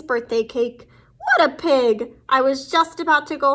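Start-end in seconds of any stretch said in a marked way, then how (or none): none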